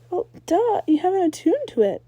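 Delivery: mocking duh. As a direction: scoff at the obvious. Ember mocking.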